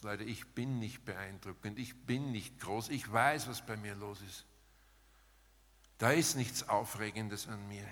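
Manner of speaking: ordinary talk, nothing marked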